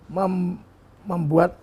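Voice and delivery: usual speaking voice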